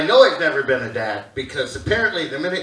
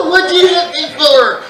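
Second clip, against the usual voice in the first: put-on kid protest; 9 semitones higher, 7 dB louder than usual.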